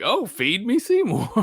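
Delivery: high, laughing plant voice; mock movie line. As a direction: quote the musical line through laughter. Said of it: "I was like".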